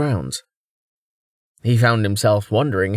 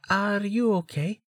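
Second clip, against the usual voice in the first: high, soft whispered question; hushed, high-voiced check-in; 10 semitones higher, 6 dB quieter than usual. A narrator's reading. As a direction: ask it softly under your breath, pitched up. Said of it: "Amelia whispered".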